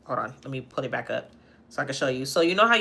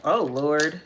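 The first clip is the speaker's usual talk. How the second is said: normal talking voice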